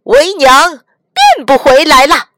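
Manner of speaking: loud, high, triumphant boom; triumphant roar. Acted. Monkey King unmasking himself to the demon, triumphant shout. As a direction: throw the head back and roar it out. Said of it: "孙悟空仰天大笑".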